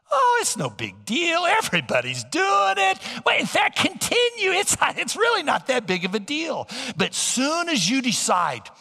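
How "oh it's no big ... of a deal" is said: much higher, sly, dismissive tempter; a higher tempter voice brushing the sin off; Satan's voice. Acted. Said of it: "what Satan does is he minimizes it".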